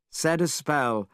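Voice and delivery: ordinary delivery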